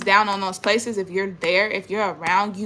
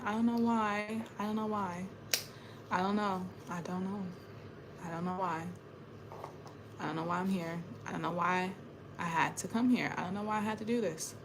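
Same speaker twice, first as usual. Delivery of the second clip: despairing murmur; 13 dB quieter than usual. Real, not acted. Despairing repeated I don't know why.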